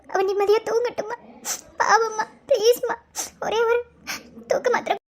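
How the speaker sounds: tearful pleading. Acted. Begging mother for a sleeping pill.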